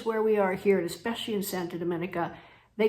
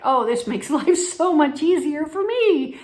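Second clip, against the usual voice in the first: higher, louder retold delight; delighted relief; 8 semitones higher, 7 dB louder, slower than usual. Shopper learning any color suits weddings, delighted relief. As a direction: act out happy relief, pitched up. Retells her relief at the news.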